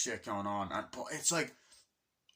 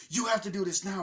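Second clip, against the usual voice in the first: mock yelled order; 10 semitones higher, 7 dB louder than usual. Sergeant barking at rookie.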